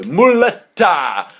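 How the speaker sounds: high, loud, drawn-out shout of Muletta!; really loud call. Retold or imitated. Pupils mocking a mullet-wearing girl, loud drawn-out call. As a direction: belt out Muletta! slow and high. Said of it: "I really loud".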